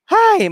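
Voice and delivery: a high laugh melting into a sigh. Real, not acted. Speaker laughing off rejections to his audience, high amused sigh. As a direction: laugh it off with a big exasperated sigh.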